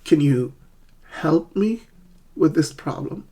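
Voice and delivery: pauses around a high "help me", rising "problem"; stressed words, chunked. Shown as a demonstration. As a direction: break it up to show "help" and "problem". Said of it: "two words in the sentence are stressed".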